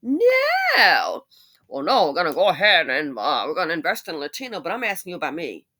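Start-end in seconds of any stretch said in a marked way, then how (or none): 0.0-1.2 shrill high flat no, much higher and louder; mock politician. Mocking a politician's refusal, high and loud. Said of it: "you're like". 1.7-4.6 lightly voiced evasive politician; mock politician. Mimicking an evasive politician, a hint louder. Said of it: "you're like".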